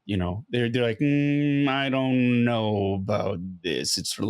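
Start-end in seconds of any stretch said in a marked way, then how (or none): 1.0-4.0 long, drawn-out doubtful hedge; unsure, skeptical. Retold or imitated. Fans doubting the actor's version of Iroh, slow and skeptical. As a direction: drag it out like a side-eye. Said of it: "they weren't really sure".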